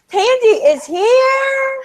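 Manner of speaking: very high, loud, stretched-out squeal; thrilled announcement. Real, not acted. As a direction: shriek the guest's arrival slowly with glee. Announces the awaited guest arriving.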